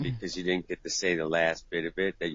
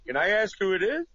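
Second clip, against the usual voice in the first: much higher polite voice; polite phone screener; 10 semitones higher, 4 dB louder than usual. Retold or imitated. Guy faking polite voice to screen a call.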